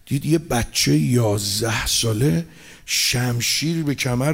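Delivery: low, soft storytelling of a sad scene; hushed lament voice; hushed mourning narration. A narrator's reading. Rowze narration of boy's plea.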